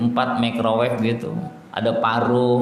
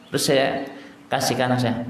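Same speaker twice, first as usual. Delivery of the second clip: usual speaking voice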